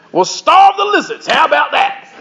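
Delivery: put-on high, amazed Australian accent; Aussie exclamation. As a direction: exclaim it like an astonished Aussie crowd. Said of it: "here's from the Aussie Bible".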